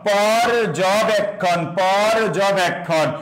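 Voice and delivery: পর্যবেক্ষণ very slowly, syllables drawn out; pronunciation demo. Shown as a demonstration. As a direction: stretch পর and য in পর্যবেক্ষণ for learners to repeat. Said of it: "এবার আসো, উচ্চারণ করো".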